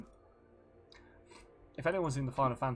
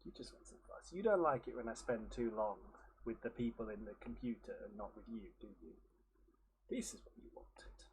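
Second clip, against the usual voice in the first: soft talk to cat; 8 dB quieter than usual. Real, not acted. Talking softly to the cat.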